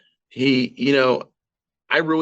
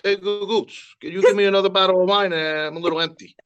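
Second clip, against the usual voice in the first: higher, fast jovial dad voice; breezy father asking the daughter for wine, higher and quick; imitating her father; 5 semitones higher, 3 dB louder, faster than usual. Dad calling for more wine, quick and high. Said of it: "this is your father right here".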